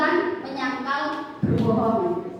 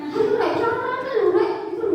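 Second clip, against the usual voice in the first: much higher, pally coaxing like a classmate; chummy urging; 8 semitones higher than usual. Retold or imitated. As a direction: egg your friend on. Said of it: "Itu sebagai teman". (ajakan akrab bernada tinggi)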